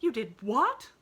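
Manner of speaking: shocked. A narrator's reading. Nancy stops short, shocked.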